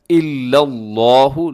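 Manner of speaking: इल्लल्लाहु with a heavy, rounded "law" in the middle; thick lam. Shown as a demonstration. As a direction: fill the mouth on the long lla of इल्लल्लाहु. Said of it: "लफ्ज़ अल्लाह को आपने मोटा पढ़ना है".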